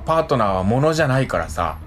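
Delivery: ordinary talk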